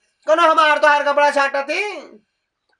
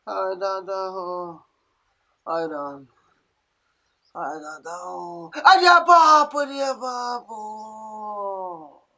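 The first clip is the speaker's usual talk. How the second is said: drawn-out groan; 6 semitones lower, 4 dB quieter, much slower than usual. Acted. Old woman groaning in pain.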